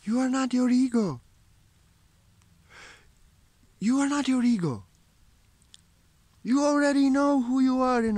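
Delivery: high, loud, ringing preacher's call; fervent preaching. Acted. Spiritual teacher rousing viewers to leave ego behind, in fervent preaching. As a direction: proclaim it to the whole hall.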